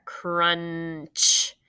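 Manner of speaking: Crunch with a long held n before ch; stretched out. Shown as a demonstration. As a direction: draw out Crunch to expose the ending blend. Teacher stretches the blend in the word.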